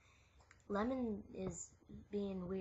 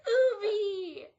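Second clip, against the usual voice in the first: imitating Ubi; 8 semitones higher, 13 dB louder than usual. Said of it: "when they're mad, they're like".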